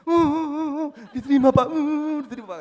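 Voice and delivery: very loud, high-pitched mock bawling; hammed-up wailing shout; imitated crying and yelling. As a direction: bawl it out in exaggerated sobs. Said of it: "dia menangis teriak di meja makan".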